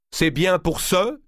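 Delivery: slow, high emphatic cry; exclamation. A narrator's reading. Valois seizes the argument, a slow high exclamation. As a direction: seize the point and shout it. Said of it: "s'écria Valois".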